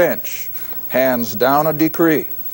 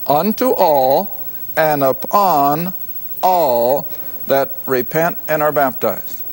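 ordinary talk, nothing marked

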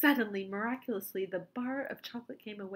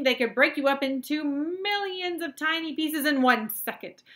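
very high, very loud frantic warning; alarmed shouting; 7 semitones higher, 16 dB louder than usual. A narrator's reading. Wonka warning Mike away from the camera, alarmed shouting. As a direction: yell to halt someone in danger.

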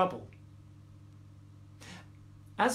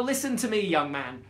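ordinary delivery

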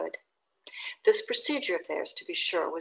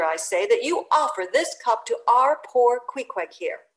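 louder and higher, climbing to near-shout; mounting indignant tirade; 5 semitones higher, 6 dB louder than usual. Acted. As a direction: pile the scorn on the steward, ever higher. Stubb berating the steward.

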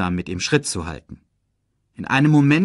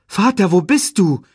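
much higher, louder cry of "Vater"; calling out; 9 semitones higher, 8 dB louder than usual. Acted. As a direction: call up into the night sky. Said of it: "rief er".

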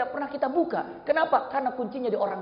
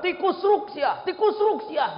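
playful slang; 7 semitones higher, 4 dB louder, slower than usual. Retold or imitated. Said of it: "Kalau bahasa kerennya tikus ruk".

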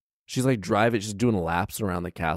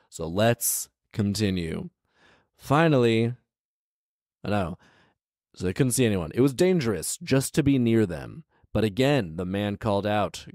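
ordinary talk, nothing marked

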